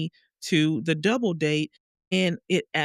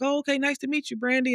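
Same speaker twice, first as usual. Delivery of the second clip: much higher, bright mock-polite greeting; sugary friendly greeting; 9 semitones higher, faster than usual. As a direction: play the overly sweet hostess. Friends greeting her sweetly.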